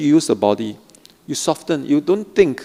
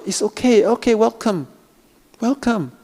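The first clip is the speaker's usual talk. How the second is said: welcoming; 9 semitones higher than usual. Shown as a demonstration. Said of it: "So just, if it comes out".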